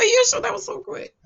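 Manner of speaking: high-pitched retold amazement; mock pleasant surprise. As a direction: react to a child with playful disbelief. Mother reacts to child's finished chore.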